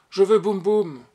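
imperious little plea; ardent, pleading demand. A narrator's reading. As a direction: beg and order at once. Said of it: "d'un ton ardent et suppliant et impératif".

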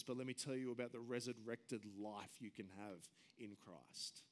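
usual speaking voice

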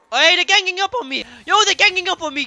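high-pitched alarmed cry; worked-up yelp for help. Real, not acted. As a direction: call out that you're cornered. Zombies surround the player.

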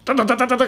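rapid high-pitched engine stutter; imitated motorbike. Preacher describes roadside motorbike splashing girlfriend, imitated engine. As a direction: rattle it out like a passing motorbike. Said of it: "when the motorbike".

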